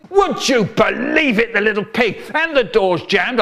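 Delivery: dad blowing up at the little pig; hammed-up angry dad; dad roaring. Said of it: "he's roaring".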